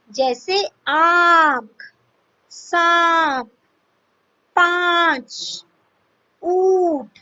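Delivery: आंख and पांच slowed, vowel nasalized through mouth and nose. Shown as a demonstration. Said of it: "मुख तथा नाक दोनों से होता है". (आंख, पांच में अनुनासिक स्वर खींचकर)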